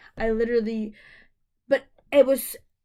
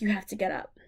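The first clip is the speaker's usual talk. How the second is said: whispered quote; 4 semitones lower, 4 dB quieter, faster than usual. Retold or imitated. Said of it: "they're like".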